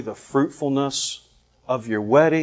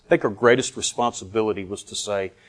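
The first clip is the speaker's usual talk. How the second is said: usual speaking voice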